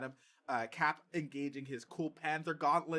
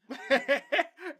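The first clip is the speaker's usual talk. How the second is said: high laugh; 12 semitones higher, 8 dB louder than usual. Real, not acted. Laughs at Costner question.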